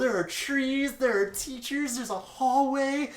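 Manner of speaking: high, loud, giddy listing with a laugh; giddy gushing. Acted. New girl lists school wonders to Kakyoin, giddy. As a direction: squeal out each thing like a treasure.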